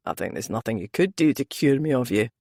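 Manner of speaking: low Scottish brogue, male lead; deep, easy Scottish man. A narrator's reading. Male character voice.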